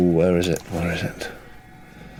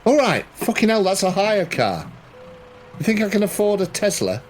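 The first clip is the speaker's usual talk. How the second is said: high, exasperated protest; indignant outburst; 10 semitones higher than usual. Acted. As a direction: protest the damage to your hire car. Player indignant at the kicked car.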